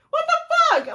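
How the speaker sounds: high, loud disbelieving yelp; what the fuck, half laughing in shock; shocked outburst. Real, not acted. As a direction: blurt it out in sheer disbelief. Shocked reaction to highway route.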